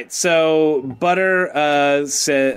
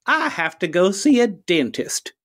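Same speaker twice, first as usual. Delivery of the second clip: higher, theatrical formal farewell; courteous bull lawyer; 5 semitones higher than usual. Acted. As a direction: gentleman fighter's parting line. Player voices Butter to the judge.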